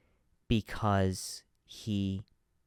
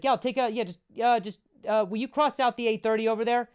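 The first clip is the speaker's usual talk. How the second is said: chirpy high-pitched receptionist voice, louder; high customer service voice; 13 semitones higher, 5 dB louder than usual. Retold or imitated. On the phone to the massage client, bright high service voice. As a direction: play the eager front-desk guy, pitched way up.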